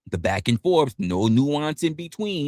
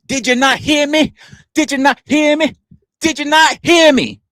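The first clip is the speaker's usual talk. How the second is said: high, louder, insistent mocking demand; 12 semitones higher, 6 dB louder than usual. Streamer mimicking a chatter, demanding loudly. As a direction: repeat it like an outraged chatter.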